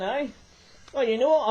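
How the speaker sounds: ordinary talk, nothing marked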